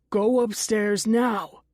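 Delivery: slow, forceful command; a restrained shout, each word weighted; shouted order. A narrator's reading. Dennis ordering Connie upstairs, shouting. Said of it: "he shouts".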